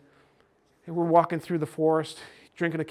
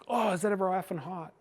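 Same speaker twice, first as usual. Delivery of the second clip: raised-pitch imitation of a child's reaction; little girl exclaiming; 4 semitones higher than usual. Niece after hot sauce on her tongue, mock exclamation. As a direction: lightly mimic a child reacting to a burning mouth.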